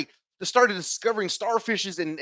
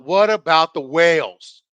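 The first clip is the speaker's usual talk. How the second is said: loud, slow mock yell at usual pitch; retold yell; 8 dB louder, slower than usual. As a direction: drag out the heckle loudly, imitating it. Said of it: "This motherfucker yelled out".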